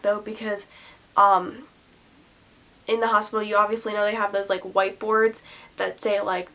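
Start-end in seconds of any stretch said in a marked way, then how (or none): none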